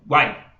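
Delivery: "guai" in one burst, all vowels glided together; undivided syllable. Shown as a demonstration. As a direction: say "guai" without dividing it. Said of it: "Eu não divido".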